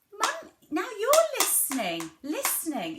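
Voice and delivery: high, exaggerated praise to a toddler; demonstrated mummy-to-child voice. Shown as a demonstration. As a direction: stretch "listening" up high, then let it fall. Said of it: "introducing that word".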